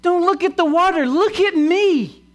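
high, loud preacher's cry as Jesus; shouting. Retold or imitated. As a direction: shout it out to a whole crowd. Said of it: "He shouts it".

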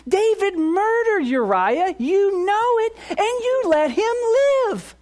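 a shrill accuser pleading his case; accusing Satan. Acted. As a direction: sneer the charges up to heaven. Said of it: "can we go down the list".

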